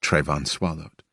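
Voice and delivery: plain reading voice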